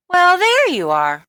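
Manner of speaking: high, loud motherly voice; mother's voice. Acted. Mother greeting her son in a high, loud voice. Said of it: "said Missus Midas".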